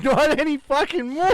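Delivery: high, loud, comic punchline shout; loud mock retort. Pupil answering the teacher's praise of the castle, loud mock retort.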